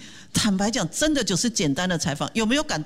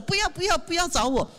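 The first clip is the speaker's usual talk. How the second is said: mock refusal; 9 semitones higher than usual. Volunteer refusing group leader.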